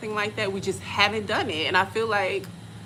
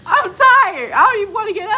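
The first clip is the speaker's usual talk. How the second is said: high, loud, hammed-up griping; whiny complaint; 11 semitones higher, 8 dB louder than usual. Tired traveler refusing to get up, whiny. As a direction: complain in an exaggerated whine. Retold protest, too tired.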